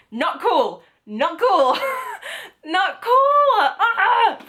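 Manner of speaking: high, wailed protest breaking into laughter; mock outrage. Real, not acted. Reader scolding Natasha Preston over the ending, mock outrage. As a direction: scold the author in playful, squealing indignation.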